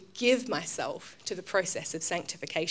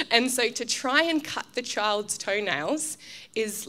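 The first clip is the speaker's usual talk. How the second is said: normal talking voice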